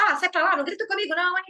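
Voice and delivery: mock angry comeback; snapping back. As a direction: hit back in a huff. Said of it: "um impulso é revidar de volta".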